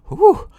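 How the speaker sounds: very high, loud whoop; loud, high-pitched "whew"; exclaimed "whew". A narrator's reading. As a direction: whoop in thrilled relief. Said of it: "exclaimed Yoko Bow".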